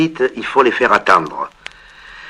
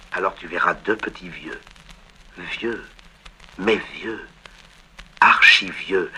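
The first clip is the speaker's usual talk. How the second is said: soft, quiet reading of a tender letter; recitation; 2 semitones lower, 5 dB quieter than usual. Acted. Auditioning actor reading a letter to a casting agent, softly. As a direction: read the letter gently for the audition.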